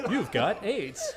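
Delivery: slow, laughing delivery; laughing repeat. Acted. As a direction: laugh the line out slowly.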